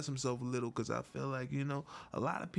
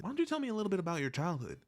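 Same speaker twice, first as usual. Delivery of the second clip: high Nardwuar voice; 8 semitones higher, much faster than usual. Pretend. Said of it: "changed into Nardwuar".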